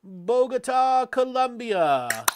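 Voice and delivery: drawn out, big, loud and high; announcer call-out. Acted. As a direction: announce it like a game-show host. Host echoing the caller's city.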